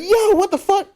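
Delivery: very high-pitched retold astonishment; shocked disbelief. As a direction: replay stunned alarm, voice jumping up. Acted shock after witnessing slap.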